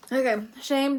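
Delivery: usual speaking voice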